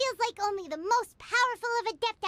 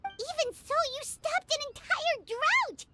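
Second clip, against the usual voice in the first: amazed; 7 semitones higher than usual. Acted. Paimon amazed at stopping drought.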